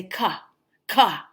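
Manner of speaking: "ka" with a voiced uh tacked onto the k; added uh vowel. Teacher imitating students in China, "ka" with an extra uh. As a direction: imitate learners saying "ka" for a bare k. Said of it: "pronounce the uh at the end".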